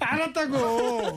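exasperated. Real, not acted. Cutting off endless food talk.

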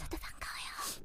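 soft whispered reply. Pretend. Host returning the greeting, whispering. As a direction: whisper back playfully. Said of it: "목소리가 안 나와요".

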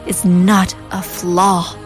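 normal talking voice

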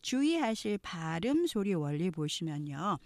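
ordinary talk, nothing marked

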